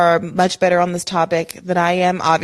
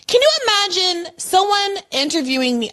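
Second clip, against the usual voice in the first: usual speaking voice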